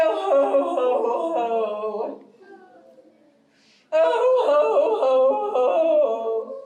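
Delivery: loud drawn-out "ho, ho, ho" falling away; bear's moaning "ho". Acted. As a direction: wail it like a woeful bear. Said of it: "And then go".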